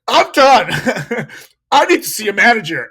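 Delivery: loud, high huffy I'm done, imitating her; mock huffy customer. Server mimicking a customer demanding a manager, huffy. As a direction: stamp it out like an offended diner. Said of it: "she kept doing this".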